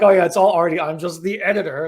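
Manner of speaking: loud, light imitation of a modest friend; modest Gary. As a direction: brush off the credit like Gary. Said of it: "Kind of like Gary's like".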